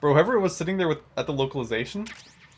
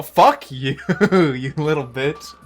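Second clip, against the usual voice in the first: loud laughing insult; 3 semitones higher, 8 dB louder, much slower than usual. Pretend. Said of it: "Raiden's just, you know".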